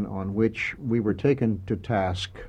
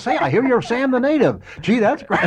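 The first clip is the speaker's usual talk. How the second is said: high, loud, gushing congratulations; mock-excited caller; 13 semitones higher, 6 dB louder than usual. Imitating excited congratulatory phone callers.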